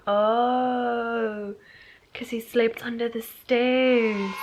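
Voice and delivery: drawn-out "oh, now I get it"; sudden realization. Retold or imitated. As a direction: let the penny drop slowly, dragging it out. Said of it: "now I'm like".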